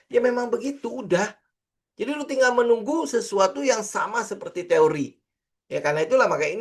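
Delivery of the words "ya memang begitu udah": lightly imitating an offhand it's-that-easy; mock casual. Teacher imitating a trader who talks it simple, mock casual. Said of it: "lu ngomongnya simple banget".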